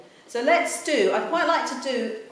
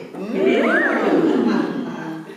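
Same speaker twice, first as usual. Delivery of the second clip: happy hum with a laugh; 5 semitones lower, 5 dB louder than usual. Shown as a demonstration.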